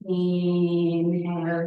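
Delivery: long, loud, sustained INHALE; drawn-out breath cue. Real, not acted. Yoga teacher leading students into a breath, long drawn-out cue. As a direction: stretch "Inhale" out like the breath itself.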